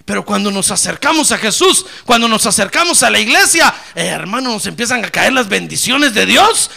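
builds from strong to loud, high praise; building preaching. Real, not acted. Pastor to congregation, building to ecstatic praise. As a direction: climb into a shouted gloria.

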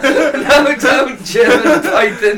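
high, loud German cry; slow, shouted mock-German plea; mock German accent. Joking about searching python in pants, loud camp German accent. Playing the German in panic again.

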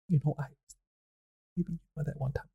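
very soft, halting false start; hesitant mumbling. Acted. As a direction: trail into a barely audible, awkward mumble. Hesitant character working up to a confession.

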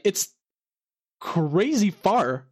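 ordinary delivery

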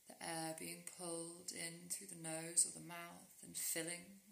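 soft, unhurried meditation voice with long pauses; guided sleep meditation. Real, not acted. Guiding a listener into rest with yoga nidra, calm meditation voice.

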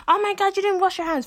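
loud, high-pitched mock shriek; imitating outraged sister. Sister calling out unwashed hands, loud high mimicked outrage. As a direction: mimic her tattling outcry, high and loud. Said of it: "I can hear her going".

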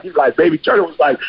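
usual speaking voice

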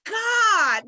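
high, loud drawn-out call on God; mock scream to God. Host showing how we scream to God, mock scream. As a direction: cry out upward, half screaming. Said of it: "we are going to scream".

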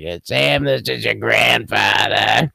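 slow, mock Boston grandfather on the phone; imitating grandfather. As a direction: call like Grandpa would, slowly. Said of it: "he used to call me".

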